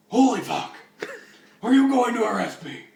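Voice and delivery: loud, high, alarmed cry; shocked outburst. Acted. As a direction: blurt it out in hammed-up shock. Bruce reacts to being exposed.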